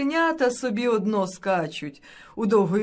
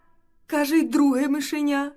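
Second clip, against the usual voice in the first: usual speaking voice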